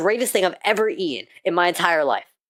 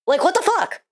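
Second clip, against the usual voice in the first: outraged disbelief; 13 semitones higher than usual. Real, not acted. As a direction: react in outraged shock. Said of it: "Sorry, rant over".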